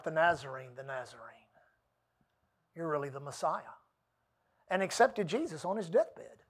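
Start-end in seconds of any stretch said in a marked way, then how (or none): 0.0-3.8 low, soft, awed cry of recognition; hushed retold cry. Dying man crying out to Jesus, soft and awed. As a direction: breathe out the name in hushed awe. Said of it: "he cried out".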